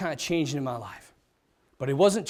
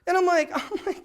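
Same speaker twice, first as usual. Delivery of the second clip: normal talking voice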